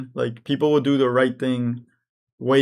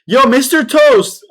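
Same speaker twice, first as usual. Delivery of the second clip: loud, slow call to a chatter; 9 semitones higher, 8 dB louder, slower than usual. Real, not acted. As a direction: shout the name across the room. Calling out to a chatter.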